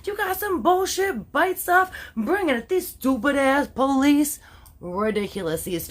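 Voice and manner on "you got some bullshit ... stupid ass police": hammed-up defiant thug girl daring the cops; mock thug. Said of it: "they were full out thug".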